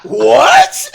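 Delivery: high, loud laughing what; shocked laughing what; stunned what. Retold or imitated. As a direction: yelp it in shock. Stunned reaction retold.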